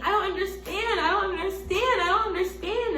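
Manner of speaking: high, bewildered repeated plea; distraught pleading. Retold or imitated. Crying to the producers after the rejection, high and pleading. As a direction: plead it high, lost and hurt. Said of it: "I'm literally saying".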